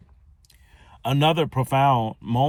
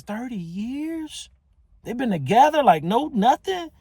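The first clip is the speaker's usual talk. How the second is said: disbelief; 5 dB louder than usual. Retold or imitated. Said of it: "I'm getting frustrated with him".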